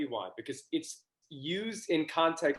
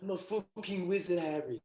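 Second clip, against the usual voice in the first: softer mock British accent; British accent impression; 9 dB quieter than usual. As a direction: echo it in a put-on British accent. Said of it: "I know my accent is so shite".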